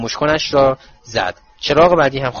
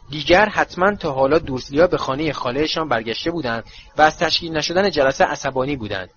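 usual speaking voice

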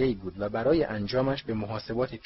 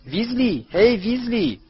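high, loud taunting yell across the hall; loud shout; 11 semitones higher, 6 dB louder, slower than usual. Acted. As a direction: call him out loudly from behind the queue. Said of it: "صدای بلندی از پشت سرشان داد زد".

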